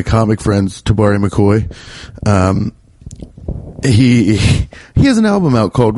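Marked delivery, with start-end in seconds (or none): none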